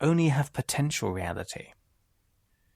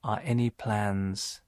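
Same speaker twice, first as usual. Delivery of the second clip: guided meditation; 2 semitones lower than usual. Acted. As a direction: ease the listener gradually down, softening and slowing. Leads a relaxation meditation.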